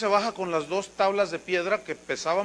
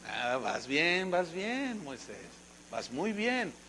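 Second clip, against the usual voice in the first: soft, slow, lower coaxing; 3 semitones lower, 9 dB quieter, slower than usual. Acted. God softly humoring the reluctant Moses. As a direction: humor him gently, like calming a child.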